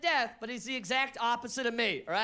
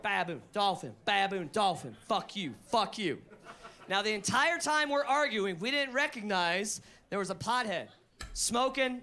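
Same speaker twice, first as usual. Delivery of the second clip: ordinary delivery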